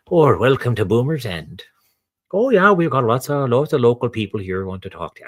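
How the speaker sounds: mock English Midlands villager, lilting; bad English accent. Villager welcoming visitors to Boomers End, bad English accent. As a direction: attempt a rural English accent that slips towards Minnesota. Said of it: "the Midlands of England".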